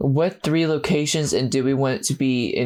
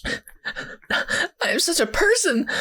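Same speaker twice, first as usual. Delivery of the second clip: hammed-up Peter; much slower than usual. Peter pleads with Michael.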